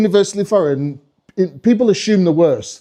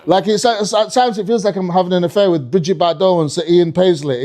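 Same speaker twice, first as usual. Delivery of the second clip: ordinary delivery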